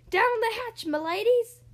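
high, loud hearty toast; loud rallying pirate cry; pirate captain's yell. A narrator's reading. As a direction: yell it down the table. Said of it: "pounded his fist on the table and yelled".

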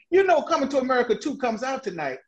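high, quick quote of a lady's tip; imitating a lady. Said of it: "the lady said to me".

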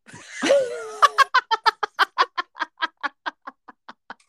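long, high burst of laughter. Real, not acted. Friend losing it over a rude word, long high laughter. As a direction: crack up helplessly.

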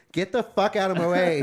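2 retold woman yelling at the guard, high and loud; 3 snap it out like a caught shoplifter storming off; 1 angry shout.

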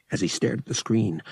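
plain reading voice

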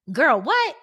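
put-on loud high shocked girl, what; surprised. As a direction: burst out in disbelief. Mock shocked reaction in ad.

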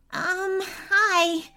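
put-on high, hesitant boy's greeting; hesitate, then offer a timid high hello; nervous. A narrator's reading. Boy approaches the nearest unicorn, nervous. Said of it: "said the boy nervously".

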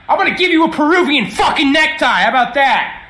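loud, fast, hammed-up threat; fast angry shout at a chatline stranger; threatening yell. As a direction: bark the threat down the line. Prank caller threatens in recorded message.

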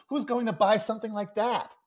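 high, loud mock outrage; cartoon kid's escalating protest; indignant comic complaint. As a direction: pile up the objections in exasperation. Acts out Calvin rejecting the tiger.

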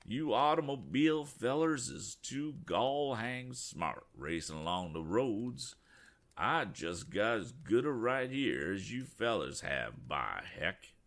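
put-on slow, drawn-out rural farmer's accent. Stubborn farmer refusing the motorists, slow rural accent. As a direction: drawl it like a stubborn country farmer. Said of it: "in unmistakable farmer's accents".

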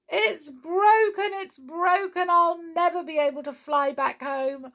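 high, loud wail of woe; howling. A narrator's reading. Witch sees her broken broomstick, howling. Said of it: "she began to howl".

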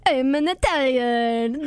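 mocking an over-the-top Italian-American accent; mock Guido Italian accent.

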